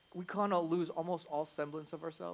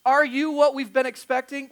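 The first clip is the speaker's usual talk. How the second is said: loud, raised-pitch challenge; insistent questioning; 8 semitones higher, 12 dB louder than usual. Retold or imitated. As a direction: demand an answer, acting out the doubter. Acting out John's doubting question.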